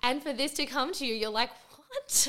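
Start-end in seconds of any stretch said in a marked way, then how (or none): 1.8-2.3 high-pitched incredulous question; shocked disbelief. Retold or imitated. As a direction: re-enact a high yelp of disbelief. Acting shock at being told.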